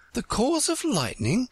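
high-pitched, certain answer; very decided. A narrator's reading. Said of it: "Alice said very decidedly".

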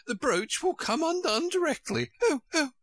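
high, wailing character voice; groaning queen. A narrator's reading. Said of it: "the poor Queen groaned out".

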